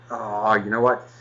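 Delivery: usual speaking voice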